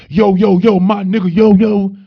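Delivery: a loud put-on street voice, not his own; mock street slang. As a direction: ham up the heavy-slang guy calling out. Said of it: "I'm not talking like that".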